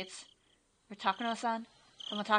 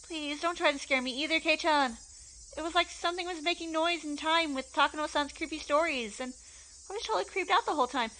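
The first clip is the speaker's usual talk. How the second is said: put-on scared girl voice; 7 semitones higher than usual.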